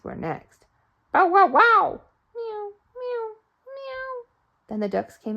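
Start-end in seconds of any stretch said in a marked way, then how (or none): 1.1-2.0 high, loud barking dog. Acted. Storyteller barking as the farm dog. 2.3-4.2 high, soft mewing cat; cat meow. Acted. Storyteller mewing as the farm cat.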